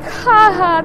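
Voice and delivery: high, short mock laugh. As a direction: laugh like you've seen a joke in a comic. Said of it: "'cause you're like".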